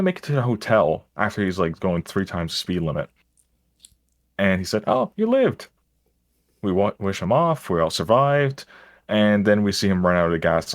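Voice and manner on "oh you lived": high, put-on driver voice; lightly voiced taxi driver. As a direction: chirp it as the cheery driver. Taxi driver quoted at hotel.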